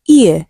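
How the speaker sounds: isolated, emphatic "ear" diphthong; diphthong demonstration. Shown as a demonstration. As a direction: sound out "ear" loudly as one glide from ee to uh. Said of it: "the vowel sound in option A is a diphthong".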